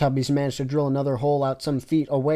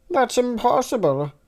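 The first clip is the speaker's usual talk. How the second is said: a touch higher Snape voice; slightly raised character voice; lightly voiced Snape; 9 semitones higher than usual. Reader voicing Snape, a touch higher.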